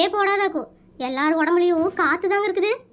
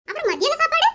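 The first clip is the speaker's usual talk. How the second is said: high incredulous question; 8 semitones higher than usual. Acted. Interviewer asking the housewife, leaping up in comic disbelief. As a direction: pitch leaps up in disbelief.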